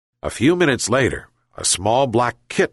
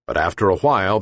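plain reading voice

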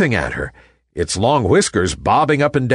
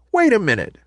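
high, startled pause; surprised; 10 semitones higher, faster than usual. Acted. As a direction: catch yourself in surprise. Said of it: "said the ballerina in surprise".

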